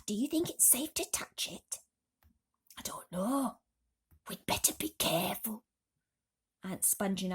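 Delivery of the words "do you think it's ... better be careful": hushed cautious aunts. A narrator's reading. Aunts cautious near peach.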